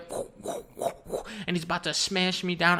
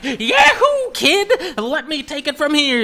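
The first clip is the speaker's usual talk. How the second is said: high, loud cowboy-style whoop; gleeful loud heroic entrance; whooping rescuer; 9 semitones higher, 8 dB louder, slower than usual. Acted. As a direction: burst in hollering, pitch way up. King Phil bursts in to rescue.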